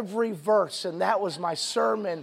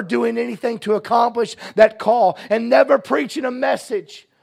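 louder and higher, building to a shout; preaching at full force; 6 semitones higher, 8 dB louder than usual. Acted. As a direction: cry out the prayer like a revival preacher. Climactic prayer retold as preaching.

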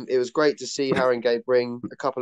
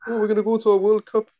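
put-on higher, quick stab at an Irish accent; attempted Irish accent; 9 semitones higher, faster than usual. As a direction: mimic excited Irish fans in a shaky-attempt Irish accent. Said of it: "I can't even do an Irish accent".

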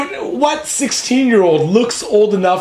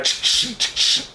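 unvoiced static crackle; bionic power sound; 7 semitones higher, 6 dB quieter, slower than usual. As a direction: hiss like radio static. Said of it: "I'm like".

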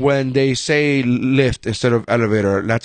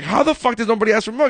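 higher, fast disbelieving question; can't believe it, rush it out; baffled shop customer; incredulous; 9 semitones higher, faster than usual. Retold or imitated.

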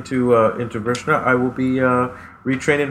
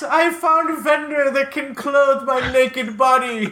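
excited adventurer voice; 12 semitones higher, 3 dB louder, slower than usual. Pretend. Triumphant game-character voice.

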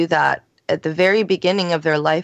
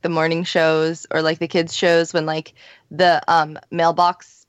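normal talking voice

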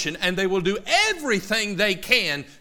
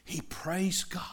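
hushed whisper; 8 dB quieter, slower than usual. Acted. Preacher telling the congregation Zacharias praised God, in a whisper. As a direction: whisper it, awed and reverent.

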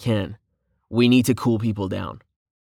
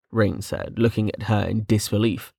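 ordinary delivery, nothing marked

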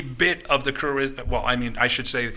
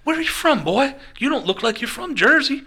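high, punchy wrestler imitation, swooping up on the questions; hammed-up, high-pitched buttonholing of a stranger; fired-up Tommy Rich; 6 semitones higher, 3 dB louder than usual. Tommy Rich quizzing a teenage waiter, fired-up imitation. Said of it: "Tommy Rich is all fired up".

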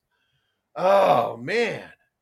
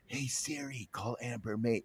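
very soft, lowered voice; hushed retelling of a yell; 7 semitones lower, 14 dB quieter, slower than usual. Retold or imitated. Host retells his command without setting the phone off, hushed. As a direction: repeat the command under your breath. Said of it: "I won't say it again".

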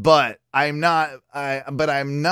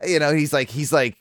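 usual speaking voice

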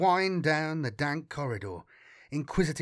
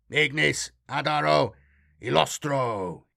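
intoning; 4 dB louder than usual. A narrator's reading. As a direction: intone it like a sacred rite. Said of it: "he intoned".

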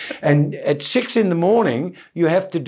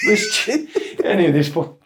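ordinary delivery

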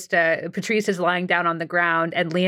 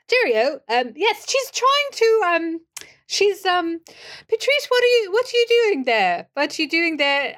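high-pitched, hammed-up British accent; fake British accent; 11 semitones higher than usual. [name], a struggling actor, fusses over Patrice in fake British accent. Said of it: "kind of a fake British accent".

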